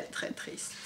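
ordinary delivery, nothing marked

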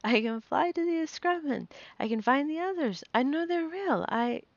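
high, loud, breathless rush of excitement; elated girl yelling to her mother; exultant shouting. A narrator's reading. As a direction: shout it out with soaring elation. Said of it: "Lara shouted and laughed".

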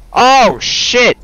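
high sudden yelp; startled shout. Real, not acted. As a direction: jump and shout. Sudden scare.

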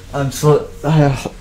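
winded, catching breath. Real, not acted. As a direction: gasp between words. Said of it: "I'm out of breath".

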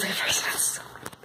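hushed, breathy whisper, fading; whispering about a coat that looks like a person; spooked whisper. Real, not acted. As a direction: whisper so the creepy thing won't hear. Scared of creepy coat nearby.

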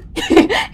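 squealing giggle; high laugh at a stumble. Real, not acted. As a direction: crack up at the tongue-twister. (risada aguda)

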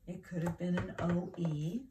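ordinary talk

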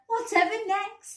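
high, mocking exclamation; hooting. A narrator's reading. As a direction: hoot it in amused disbelief. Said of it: "they hooted".

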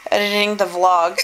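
hiccuping while talking; words broken by hiccups. Real, not acted. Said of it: "I can't stop hiccuping".

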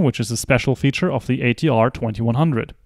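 ordinary delivery

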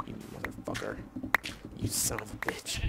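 breathless whispered curses; whispered cursing. Real, not acted. Gamer cursing the mob that broke in, in a whisper. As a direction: hiss the insults under your breath. Raging after mob attack.

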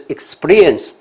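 "experience" with SPREE punched loud; pronunciation demo. Shown as a demonstration. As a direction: lean hard on the joined S-P in "experience". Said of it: "其实不是experience". (重读示范 experience)

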